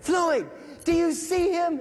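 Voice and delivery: slow, high shouted question; yelling. Retold or imitated. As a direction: yell it across the street, drawn out. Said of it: "Sergeant Watson's yelling at Floyd".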